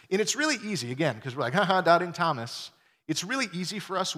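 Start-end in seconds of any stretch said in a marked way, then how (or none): 1.5-2.7 loud teasing jeer; mock taunt. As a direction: poke fun at the doubter. Mocking Thomas as people do.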